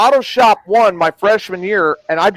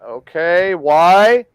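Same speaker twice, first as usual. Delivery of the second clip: ordinary delivery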